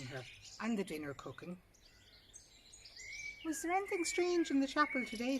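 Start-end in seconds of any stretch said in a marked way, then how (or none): 3.4-5.4 high, louder asking voice; girl's eager question. Acted. Miss Pleasant, eager, asking her sister about the chapel. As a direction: ask it bright and lifted, eager for news.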